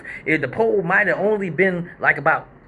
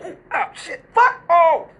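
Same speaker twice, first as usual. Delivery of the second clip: high, breathy, laughing gasp of shock; crowd wincing gasp; 12 semitones higher, 3 dB louder than usual. Retold or imitated. Imitating the audience seeing her fall, shocked gasp. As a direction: wince like the whole crowd.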